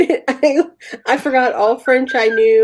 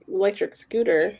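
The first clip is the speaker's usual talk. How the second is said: ordinary talk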